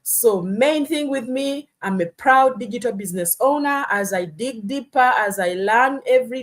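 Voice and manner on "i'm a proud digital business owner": normal talking voice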